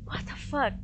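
ordinary talk, nothing marked

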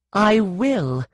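high, forceful insistence; determined threat; defiant. A narrator's reading. Threat in story.

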